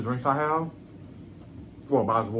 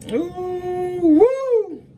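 excited whoop. Real, not acted. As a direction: let out a high, building woo. Reacting to the food.

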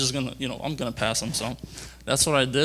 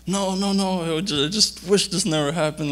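higher-pitched mock protest of regret; retold dismay; 6 semitones higher, 3 dB louder than usual. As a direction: replay the dismay of just having fallen. Rider reliving his dismay after falling.

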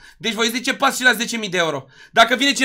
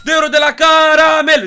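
high, loud, drawn-out announcement; hyped donation shout; 5 semitones higher, 8 dB louder, slower than usual. Real, not acted. Streamer thanks Caramel for two euros, hyped shout. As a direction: call out the donation with big hype, stretched out.

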